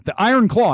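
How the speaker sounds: "The Iron Claw" high and punched; dramatic. Shown as a demonstration. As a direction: announce "The Iron Claw" like a trailer. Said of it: "why did I just say it like that".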